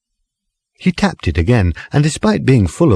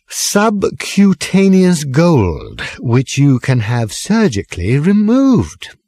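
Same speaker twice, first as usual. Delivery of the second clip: put-on high, slow, drawn-out drunken pronouncement; pompous drunk speech; 6 semitones higher, slower than usual. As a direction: lecture the pub grandly, slowly.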